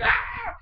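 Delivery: short, high mock scream of surprise; startled scream. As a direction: yelp as if grabbed suddenly. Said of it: "He's like".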